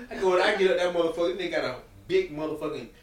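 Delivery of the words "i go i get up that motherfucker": ordinary talk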